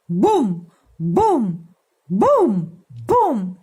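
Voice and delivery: punchy loud booms; booming hops. Shown as a demonstration. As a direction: bounce each boom like a kangaroo leap. Kangaroo hops as sound effects.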